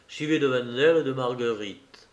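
usual speaking voice

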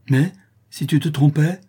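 high, loud sudden outburst; sudden cry. Acted. The creole suddenly cries out her doubt. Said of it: "s'écria tout à coup la créole".

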